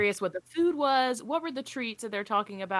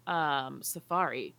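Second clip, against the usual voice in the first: usual speaking voice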